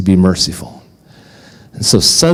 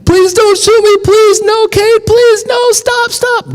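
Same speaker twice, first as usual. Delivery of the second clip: put-on very high, loud, pleading child's voice; begging; 3 dB louder than usual. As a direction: beg for your life in a squeaky high panic.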